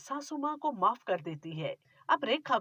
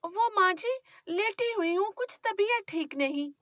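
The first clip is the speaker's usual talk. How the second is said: put-on higher, pleading young wife; young bride; 9 semitones higher than usual. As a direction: defend yourself meekly to your mother-in-law. Bride answers.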